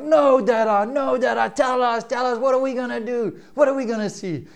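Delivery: dad imitating his children pleading; pleading kids. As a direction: beg like an impatient little kid. Father voicing his begging kids.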